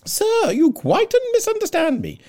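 very high, loud, slow flustered protest; alarmed Mrs Bennet. Acted. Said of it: "said Missus Bennet, alarmed".

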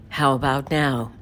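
low, slow, measured assistant voice; Siri. Retold or imitated. Voice actor proving herself to a doubting boy, in her Siri voice. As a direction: drop down and slow into the famous assistant delivery. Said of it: "You don't sound like Siri".

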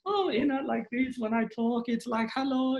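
high-pitched polite, loving mother; mom impression. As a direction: put on Mom's mannerisms, voice lifted high. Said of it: "I take on her mannerisms".

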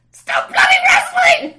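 yelled hard and high through a laugh; half-laughing retold rage at the noise; furious yell. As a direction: snap at the kids rustling in the back seat. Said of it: "I go into a rage and then I go".

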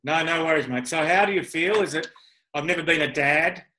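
normal talking voice

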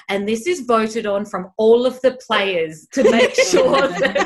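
loud, high, slow over-emphatic announcement; embarrassed insistence. Retold or imitated. Team manager mum announcing her child's award, loud embarrassed insistence. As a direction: imitate a flustered mum stressing fairness, half laughing. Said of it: "she used to be embarrassed".

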